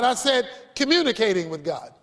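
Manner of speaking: "communicating" leaned on as the correction; stress on communicating. Shown as a demonstration. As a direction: hit "communicating" to correct the earlier word. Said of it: "No, I didn't say talking to God".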